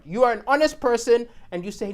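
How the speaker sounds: normal talking voice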